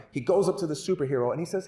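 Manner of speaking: ordinary delivery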